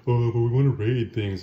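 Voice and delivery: loud, put-on complaining crew; imitated group protest; mock grumbling. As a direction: grumble like disappointed kids, hammed up. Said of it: "everybody sort of grumbles about it".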